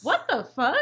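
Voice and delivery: high, loud, real crying voice; tearful disbelief. Real, not acted. Host overwhelmed by own tears, tearful disbelief. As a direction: exclaim through tears. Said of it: "don't know why I'm crying".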